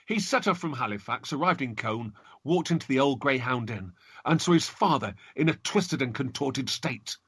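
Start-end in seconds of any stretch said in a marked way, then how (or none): none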